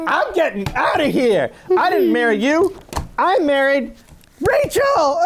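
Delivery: rising, laughing protest; laughing Jacob. Retold or imitated. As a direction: storm off while cracking up. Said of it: "And he's like".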